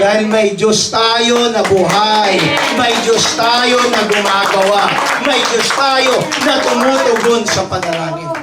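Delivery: full-force sermon cadence; preaching. Acted. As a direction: proclaim it to the congregation.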